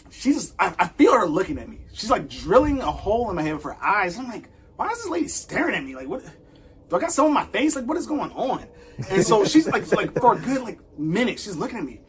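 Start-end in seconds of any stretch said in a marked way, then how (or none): 4.8-8.6 high, puzzled thinking aloud; raised, bewildered mock inner thoughts; baffled inner voice. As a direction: act confused at being stared at. Wondering why a stranger stares.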